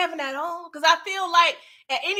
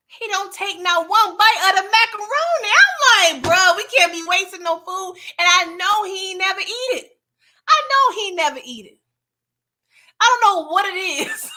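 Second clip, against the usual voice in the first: loud, higher, worked-up ranting; exasperated rant; 4 semitones higher, 7 dB louder than usual. Real, not acted. Ranting to viewers about Kevin's uneaten mac and cheese, loud and worked up. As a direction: vent at the screen about the wasted food.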